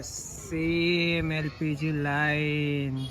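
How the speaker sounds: very slow, low, stretched-out words; drawn-out announcement. Real, not acted. Vlogger showing viewers the same gas queue, drawn-out. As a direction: drag each word out long and low.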